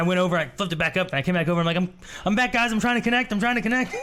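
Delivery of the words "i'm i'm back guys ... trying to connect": high, flustered gamer calling friends; pitched-up, mock-frantic repetition; retold fluster. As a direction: act out scrambling to get back online. Acting frantic gamer reconnecting to friends.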